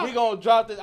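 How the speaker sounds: loud high mock rapper; hyped-up rapper imitation. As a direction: shout it like a hyped rapper in the booth. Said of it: "They be like".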